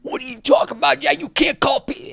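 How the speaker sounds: very high, loud outraged yelling; acted frantic shouting, voice shot way up; going berserk. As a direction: imitate a man losing it in outrage. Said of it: "went berserk".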